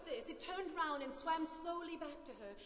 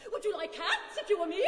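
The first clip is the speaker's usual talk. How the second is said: loud indignant challenge; worked-up, affronted mouse; shrill, passionate; 3 semitones higher, 11 dB louder than usual. A narrator's reading. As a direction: cry it out, rising in outrage. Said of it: "in a shrill, passionate voice".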